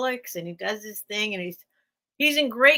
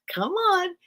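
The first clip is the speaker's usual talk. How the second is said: higher sing-song coaxing to the dog; coaxing high-pitched dog talk; 8 semitones higher than usual. Retold or imitated. Owner coaxing her old dog down the stairs, high and encouraging. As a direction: call him down gently, pitched up.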